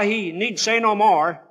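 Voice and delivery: ordinary talk, nothing marked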